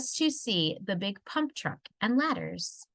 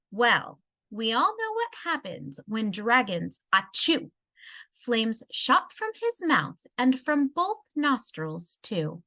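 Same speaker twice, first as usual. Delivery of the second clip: ordinary delivery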